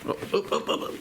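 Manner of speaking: soft whispered soothing oh's. Real, not acted. Owner whispering soothingly to a squirming cat. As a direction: hush the cat gently.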